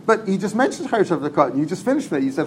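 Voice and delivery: usual speaking voice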